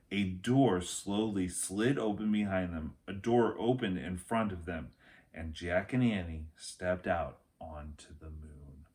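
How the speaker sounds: ordinary delivery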